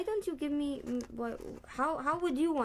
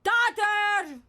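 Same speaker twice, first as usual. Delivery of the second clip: higher, louder, calling to the underworld; big loud call; 9 semitones higher, 8 dB louder, much slower than usual. Acted. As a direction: shout it so Hades hears. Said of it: "That's better".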